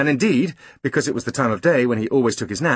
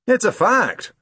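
high, firm policeman voice; insistent constable; london policeman; 11 semitones higher, 3 dB louder, slower than usual. Acted. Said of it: "said the policeman".

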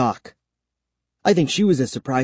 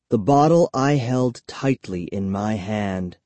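plain reading voice

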